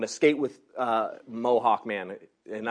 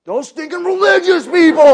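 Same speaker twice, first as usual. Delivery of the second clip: much higher and louder, scornful punk; mock indignant; 14 dB louder than usual.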